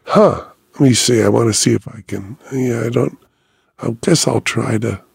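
mildly acted doctor puzzling it out aloud; hesitant doctor. Doctor attempting an injection he is unsure of, hesitant. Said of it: "like someone who's, like, trying to see if they".

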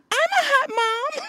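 squeaky high mock lady voice; high falsetto. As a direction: pitch way up, playful falsetto. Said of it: "we was like".

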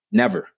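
Never leapt up high in pitch and struck hard; emphatic never. Real, not acted. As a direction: fling the word Never up high. Insisting they never saw race.

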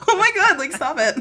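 high-pitched laughing protest; flustered giggling. Retold or imitated. Interviewer retelling her reply to the charming actor, flustered giggling. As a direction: giggle through a mock-flustered brush-off.

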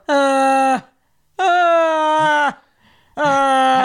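loud, high, drawn-out moans; hammed-up imitation of husband's scream moaning; mock full-blown moan. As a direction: moan it out full blast, mockingly. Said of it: "Bro, moaning, full blown".

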